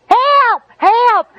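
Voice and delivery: high shouted cry for help; retold scream for help. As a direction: yell help up high and loud. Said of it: "I'm screaming".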